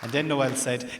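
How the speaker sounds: ordinary talk, nothing marked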